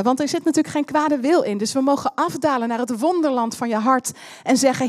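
ordinary delivery

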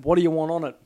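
shouted server asking about toppings; loud worker question. Retold or imitated. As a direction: call it out like a busy sandwich worker. Subway worker asks him.

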